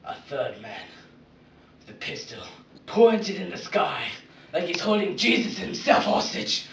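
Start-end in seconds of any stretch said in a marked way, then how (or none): 2.9-6.7 loud, building to a high shout; mounting intensity. Acted. Batman describing the man with a pistol, building loudly. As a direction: build steadily to a shouted finish. Building climax about the gunman.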